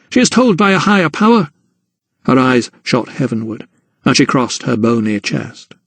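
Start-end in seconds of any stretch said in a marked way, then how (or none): 0.1-1.5 fast, higher mother's voice; a quick rebuke; mother to Emily, fast and higher; indignant mother. Acted. Mother defends gran.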